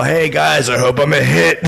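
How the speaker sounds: hammed-up stage hello. On stage, aiming his pun at Derek Jeter, hammed-up greeting. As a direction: sell the baseball pun big. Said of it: "I go like that".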